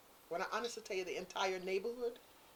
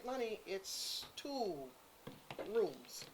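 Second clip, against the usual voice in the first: slow pointed retort; 9 dB quieter, much slower than usual. Retold or imitated. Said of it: "I said".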